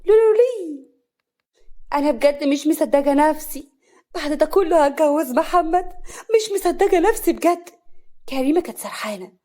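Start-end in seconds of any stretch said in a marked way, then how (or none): 0.1-0.9 high, loud festive zaghrouta; joyful ululation. A narrator's reading. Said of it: "بكل فرح". 1.9-7.7 higher and louder, giddy disbelief; overjoyed. A narrator's reading. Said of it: "بكل فرح".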